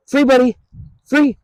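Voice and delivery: bright high-pitched "free" sung out to the dog; happy high release cue for the dog; high release call. Real, not acted.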